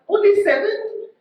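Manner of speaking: high-pitched incredulous question; mock disbelief. Real, not acted. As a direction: shoot up high in playful disbelief at the count.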